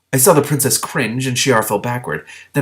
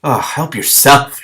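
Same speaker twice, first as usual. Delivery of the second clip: slow, louder irritated snap; cross retort; 5 semitones higher, 7 dB louder, much slower than usual. Acted. Shiar answering the weeping princess, cross. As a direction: brush her off crossly. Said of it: "Shiar said crossly".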